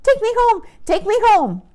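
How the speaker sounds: high, squeaky and eager, rising into a loud plea; a bouncy little show-off pup begging to be picked; excited puppy voice. Acted. Show-off puppy brother begging the visitors to adopt him, squeaky and pleading. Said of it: "He was saying".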